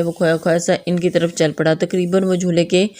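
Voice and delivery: usual speaking voice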